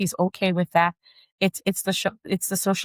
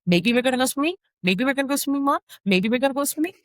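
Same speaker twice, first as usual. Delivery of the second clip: higher voice parroting the answer back; mock kid echoing maybe, higher; echoing child; 7 semitones higher, faster than usual. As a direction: repeat Mom's maybe back as a question. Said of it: "So if it's like".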